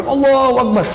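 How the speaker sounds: high, ringing acclamation; exultant high-pitched call; loud takbir. Retold or imitated. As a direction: imitate a crowd crying out the takbir. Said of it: "Saking kerasnya takbirnya".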